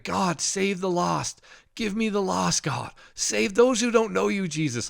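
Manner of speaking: high, pleading prayer; fervent prayer. Retold or imitated. Retelling his prayer at church, pleading high. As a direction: cry out to God for the lost.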